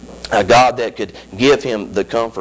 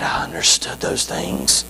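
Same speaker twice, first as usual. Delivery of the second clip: hushed; 9 semitones lower, 4 dB quieter than usual. Acted. Quiet hushed quote.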